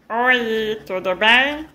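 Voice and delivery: loud, cheery puppet cow. Acted. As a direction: greet the kids as a puppet cow. Cow puppet greets the kids.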